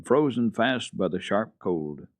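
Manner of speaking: usual speaking voice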